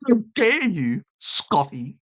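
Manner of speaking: high-pitched mock outrage; Greta impersonation. Streamer scolding Scotty in jest, Greta impersonation. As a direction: put on a high, indignant scolding voice. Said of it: "I'll do my Greta impersonation".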